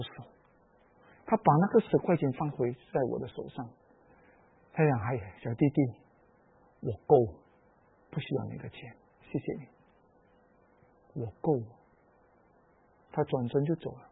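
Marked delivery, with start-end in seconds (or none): 5.0-11.6 a hint of a soft, low grandmother; gentle refusal. Old woman handing back the money, softly and kindly. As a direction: quietly turn down the gift.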